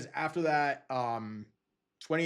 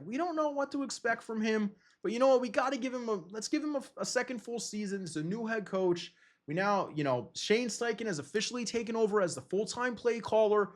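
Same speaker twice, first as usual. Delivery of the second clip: higher, eager fan voice talking yourself into hope; hopeful fan chatter; 8 semitones higher than usual. Retold or imitated. Fans unsure about Jalen hyping a second season, higher and eager. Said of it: "We're like".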